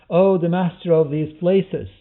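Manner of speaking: exclaimed supplication, high and loud; exclaiming. Acted. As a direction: call it out as an invocation. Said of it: "He exclaimed".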